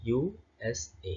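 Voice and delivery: "ইউএসএ" as U, S, A, slowly separated; broken into letters. Shown as a demonstration. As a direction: spell out "ইউএসএ" softly with gaps. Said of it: "ভেঙে ভেঙে".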